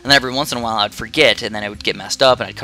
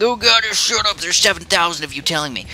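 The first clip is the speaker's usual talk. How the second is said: higher, put-on annoyed retort; mock exasperation; 6 semitones higher than usual. [name] answering the fans, mock exasperated. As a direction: snap back in mock annoyance. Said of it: "And I'm like".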